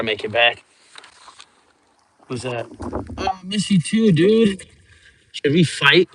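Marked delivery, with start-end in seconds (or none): none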